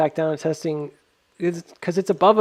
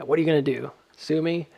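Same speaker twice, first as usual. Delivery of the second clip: ordinary talk, nothing marked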